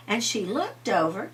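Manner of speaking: plain reading voice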